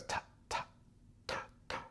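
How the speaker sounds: Ta ta breathed short with no voice; clipped voiceless ta ta; whispered staccato. Shown as a demonstration. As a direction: whisper each ta short and sharp. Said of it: "I'm also staccatoing those".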